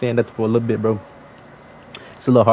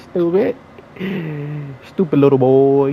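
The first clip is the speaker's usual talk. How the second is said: louder, jeering at the loser; loud mocking taunt; 4 semitones higher, 4 dB louder than usual. Real, not acted. Gamer jeers loudly at the opponent he beat. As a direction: call him out loudly, mocking.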